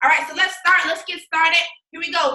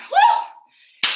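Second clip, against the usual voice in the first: high, loud celebratory whoop; triumphant woo after the last rep; excited woo; 6 dB louder than usual. Real, not acted. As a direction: whoop like you just crushed a set. Whooping after finishing the round.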